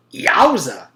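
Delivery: loud, high yowza; excited exclamation. A narrator's reading. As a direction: blurt it out in shock. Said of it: "exclaimed Beebe".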